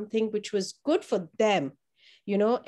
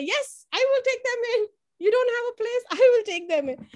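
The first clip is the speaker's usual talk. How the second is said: much higher, eager promise; 13 semitones higher than usual. Retold or imitated. Principal retelling her eager yes to the nurses' email, much higher. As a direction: jump at the offer.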